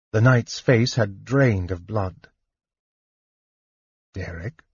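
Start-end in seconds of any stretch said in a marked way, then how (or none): 4.1-4.6 much softer, hushed question; softly. A narrator's reading. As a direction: breathe his name in disbelief. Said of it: "Sturm said softly".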